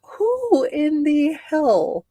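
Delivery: high and strained from laughing; crying with laughter. Real, not acted. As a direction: barely get it out through laughter. Laughing outrage at horse urine.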